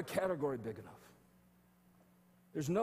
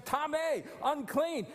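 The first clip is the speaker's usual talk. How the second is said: high, louder calling cry; leper calling out; 11 semitones higher, 4 dB louder, slower than usual. Retold or imitated. Healed leper recalls warning passersby: loud high cry. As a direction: cry it out down the street. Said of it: "walk down the street saying".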